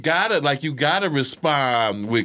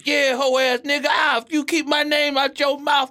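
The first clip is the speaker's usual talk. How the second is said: mock angry tirade; 9 semitones higher than usual. Comic relaying another comic's inbox message, mock angry. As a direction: go off on someone in a raised pitch. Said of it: "that dude went off on me".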